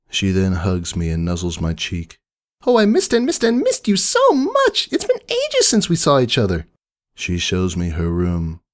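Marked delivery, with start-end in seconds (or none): 2.7-6.7 high, loud, squealing party pony; bubbly Pinkie Pie. A narrator's reading. Pinkie greets her sister Maud at the door, high and squealing. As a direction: burst out with a high, gushing surprise.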